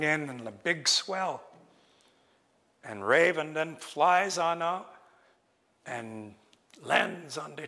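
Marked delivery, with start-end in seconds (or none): none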